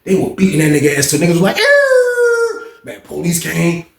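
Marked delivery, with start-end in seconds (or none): 1.6-2.6 high mock scream of pain; imitated cry of pain; pained howl. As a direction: let out a beaten man's yell. Coach crying out while beaten.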